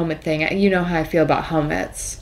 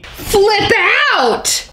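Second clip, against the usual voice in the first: shouting; 12 semitones higher, 5 dB louder than usual. Acted. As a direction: flip out, loud and high. Exclaiming the catch phrase.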